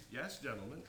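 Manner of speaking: ordinary delivery, nothing marked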